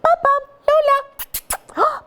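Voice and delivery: very high sing-song name calling; high-pitched calling; high puppy call. Shown as a demonstration. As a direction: call the toy names high and excited. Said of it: "we'll replace the puppy".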